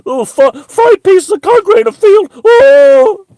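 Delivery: shrieking high falsetto, loud; mock terror. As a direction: ham up a terrified wimp. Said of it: "shaking in terror".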